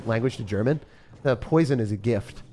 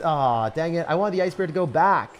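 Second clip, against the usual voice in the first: ordinary delivery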